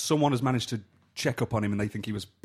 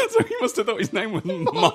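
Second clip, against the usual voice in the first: high, loud, laughing through the words; real laughing; 9 dB louder than usual. Real, not acted. Telling friends about the misheard actor's name, laughing hard. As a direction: crack up while finishing the sentence.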